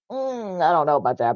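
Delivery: hesitant doubt. Retold or imitated. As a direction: imitate someone unsure and reluctant. Voicing mother's hesitant reply.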